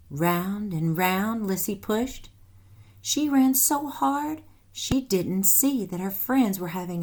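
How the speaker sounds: lively read-aloud storytelling voice; animated storybook narration for children; story narration. A narrator's reading. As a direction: read it like a picture book to kids. Reads story aloud to class.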